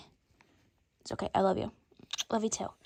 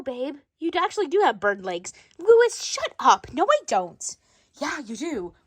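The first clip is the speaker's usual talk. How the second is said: ordinary talk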